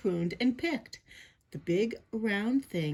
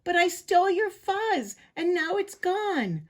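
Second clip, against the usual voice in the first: high, plaintive confession; mock-tearful high voice; crying; 10 semitones higher, 3 dB louder than usual. A narrator's reading. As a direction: wail it guiltily, up high. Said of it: "Big Bark cried".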